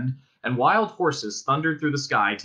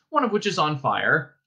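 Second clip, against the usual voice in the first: plain reading voice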